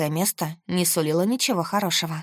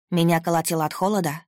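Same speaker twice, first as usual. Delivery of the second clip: plain reading voice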